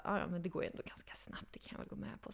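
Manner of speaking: whispered reluctant agreement. Retold or imitated. Giving in to the boss in a whisper.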